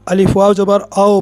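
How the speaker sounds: "आओ" ending in an open o sound; majhool vowel. Shown as a demonstration. Teacher mimics how some people misread it, majhool "आओ". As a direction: say "आओ" with a loose o glide, not u. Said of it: "ये मजहूल आवाज़ है".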